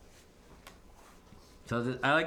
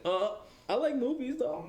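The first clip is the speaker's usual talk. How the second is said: higher-pitched imitation of an oblivious movie fan; mock clueless guy; 11 semitones higher than usual. Commentator mimicking the guy after her heartfelt speech, mock clueless. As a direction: lightly mimic him missing the point. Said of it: "he's just like".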